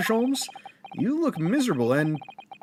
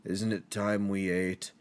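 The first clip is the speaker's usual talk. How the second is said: lower, softer, slow posh-ish drone; teasing Sholmes by imitating his low slow voice; mock Sholmes; 6 semitones lower, 5 dB quieter, slower than usual. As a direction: lightly mimic the gloomy detective. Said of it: "Are you just like".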